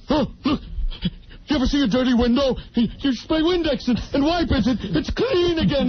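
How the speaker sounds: lightly hammed-up observational comic; rising, mock-excited prop riff; Robin Williams. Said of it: "And then Robin".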